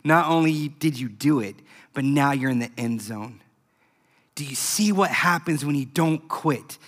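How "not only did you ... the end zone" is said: calm reassurance. Retold or imitated. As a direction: tell him gently he made it. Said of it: "The coach said this".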